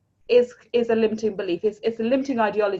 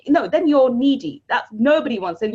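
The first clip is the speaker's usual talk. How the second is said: ordinary talk, nothing marked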